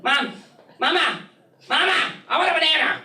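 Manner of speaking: put-on high, pleading kid voice, building; whiny child. Little girl nagging her mom at the store, high whine. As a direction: beg like a little girl for a banana. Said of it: "She was screaming at her mom".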